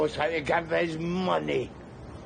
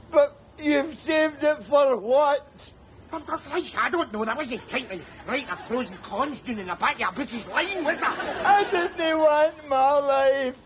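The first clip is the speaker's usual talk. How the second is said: anguished protest; 7 semitones higher than usual. Acted. Rescued man to the tramp, near tears and high. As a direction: let it break between laugh and tears.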